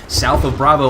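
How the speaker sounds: normal talking voice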